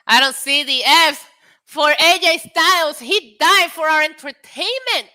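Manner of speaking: loud, high plea to the chat; high, insistent holler for a tribute; mock-outraged demand. Real, not acted. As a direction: raise the voice in theatrical indignation. Demanding tributes from chat, louder repeat.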